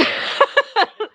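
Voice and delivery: loud, high burst of laughter; big laugh. Real, not acted. Streamer tickled by own accent, bursting out laughing. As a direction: crack up out loud.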